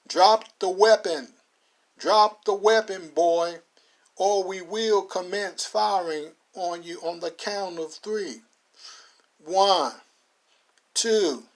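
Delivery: slow, loud commanding order; sheriff command. Acted. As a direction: count him down with menace.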